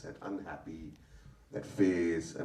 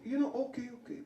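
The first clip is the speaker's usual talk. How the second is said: imitating Javed Sahab; 10 dB louder than usual. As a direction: mimic an elder confessing his lisp.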